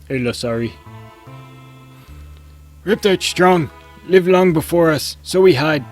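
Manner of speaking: high, loud broken-English caveman. Acted. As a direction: bellow it like a dopey cave elder. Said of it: "I can't do the caveman voice".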